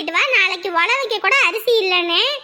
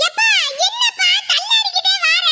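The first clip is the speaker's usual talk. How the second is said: calling out; 9 semitones higher than usual. Acted. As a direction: call across to him in alarm. Child calls out to staggering father.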